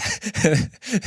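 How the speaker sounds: high, soft self-conscious laugh; embarrassed laugh. Real, not acted. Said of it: "This probably sounds so pathetic".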